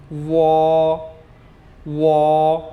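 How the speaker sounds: वॉ drawn out with a rounded w; Chinese wo. Shown as a demonstration. Teacher modelling pinyin wo, drawn-out वॉ. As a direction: say वॉ slowly as one held syllable. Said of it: "Chinese में से बोलेंगे".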